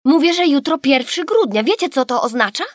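put-on high, loud insistent child; insistent girl. A narrator's reading. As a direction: press the point impatiently. Gabrysia insists again. (natarczywie, podniesionym głosem)

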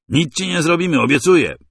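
high, loud false promise; calling out. A narrator's reading. Masai coaxing the narrator out, loud call. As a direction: shout a fake reassurance. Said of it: "wołał".

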